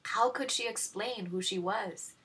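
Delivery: ordinary delivery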